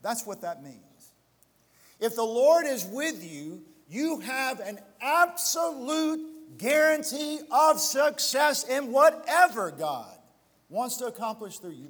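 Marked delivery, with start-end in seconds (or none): 2.0-11.9 slow, louder and higher, every word weighed; slow emphatic second reading; read slowly. Shown as a demonstration. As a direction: spell it out slowly for the whole church. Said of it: "so I'm gonna read this slowly".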